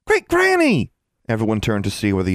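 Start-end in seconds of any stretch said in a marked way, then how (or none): 0.1-0.9 put-on high, loud joyful child call; excited child shout. A narrator's reading. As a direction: call out happily like a little girl. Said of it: "Whimsy exclaimed".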